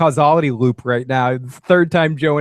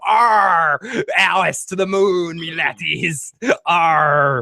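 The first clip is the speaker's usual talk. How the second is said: botched impression that turns pirate; hammy pirate growl with long "arr"; Jackie Gleason; 2 semitones higher, slower than usual. Host mocking Phil's impression, hammy pirate voice. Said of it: "impression is a fucking pirate".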